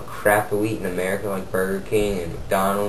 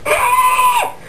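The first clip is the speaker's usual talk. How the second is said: very high, piercing squeal; mock shriek; 5 dB louder than usual. Storyteller acts out the moment the gun goes off, mock shriek. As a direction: let out a loud, high squeal. Robot pulls out gun, fires.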